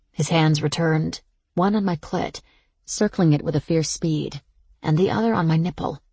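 ordinary delivery

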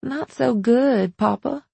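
put-on troubled young mother's drawn-out answer; worried daughter. A narrator's reading. Worried daughter answers her father.

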